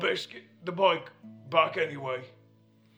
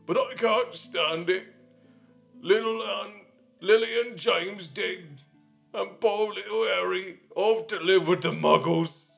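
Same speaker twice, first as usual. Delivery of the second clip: wailing grief; 10 semitones higher, 11 dB louder than usual. Acted. Hagrid mourning Lily and James, loud wailing. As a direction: cry it out like a big baby.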